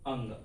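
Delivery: అంగ్ said as a short, falling "ang"; nasal letter demo. Shown as a demonstration. Teacher repeating the letter for students, clear and falling. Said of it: "ఇదాన్ని అంగ్ అనాలి".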